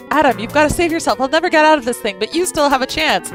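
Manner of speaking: high, loud urgent plea; urgent pleading voice. Acted. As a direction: beg him to go. Delphinius sacrifices himself.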